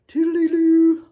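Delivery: high, loud sing-song farewell; playful sign-off. Pretend. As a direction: trill it as a silly goodbye.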